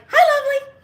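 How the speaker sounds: very high, loud sing-song hello; bright greeting. Retold or imitated. Walking into the shop, a very high excited hello. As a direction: greet her like an old friend.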